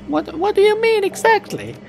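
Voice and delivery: high squeaky voice. Acted. High put-on voice.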